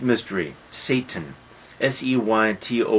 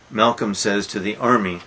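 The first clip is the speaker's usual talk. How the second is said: ordinary delivery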